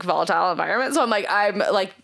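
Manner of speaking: ordinary talk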